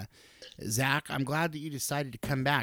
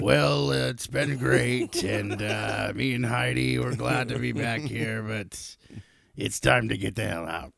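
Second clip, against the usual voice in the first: Zach; 5 dB louder, slower than usual. Retold or imitated. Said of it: "Zach, like".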